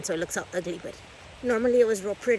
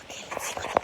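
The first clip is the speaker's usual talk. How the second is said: wildlife watching whisper; 4 dB quieter than usual. Real, not acted. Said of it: "I'll try to walk soft".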